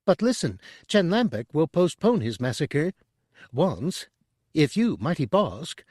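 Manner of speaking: high, fast eager chatter; high, quick Chadrafan. A narrator's reading. Tooty selling Chen to Bosk, high and quick. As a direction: rush the pitch like a nervous broker.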